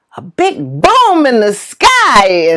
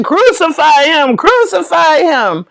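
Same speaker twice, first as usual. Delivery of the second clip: imitated crowd yell, raised pitch; mock yelling; 6 semitones higher than usual. Retelling the play crowd's demand, mock yelling. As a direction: call it out like an angry mob. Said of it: "So they're yelling".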